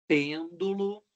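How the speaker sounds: pêndulo with a strong, long PÊN; pêndulo set apart, PÊN leading; stress on antepenultimate syllable. Shown as a demonstration. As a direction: hit the first syllable of pêndulo. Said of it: "o acento recai na antepenúltima sílaba".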